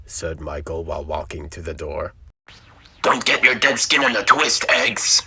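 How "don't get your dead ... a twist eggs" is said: very loud, high harsh robot voice; clown-faced robot. Acted. Robot teasing Michael at breakfast, loud high robot voice. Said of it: "said the clown-faced robot".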